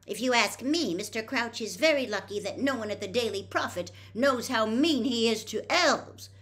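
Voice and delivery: put-on a girl furious about mistreated house-elves; angrily. A narrator's reading. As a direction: lash out on the elves' behalf. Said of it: "said Hermione angrily".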